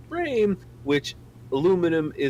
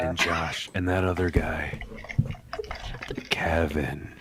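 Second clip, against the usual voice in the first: ASMR whisper; 7 semitones lower, slower than usual. Acted.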